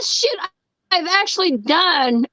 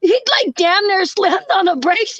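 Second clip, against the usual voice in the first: usual speaking voice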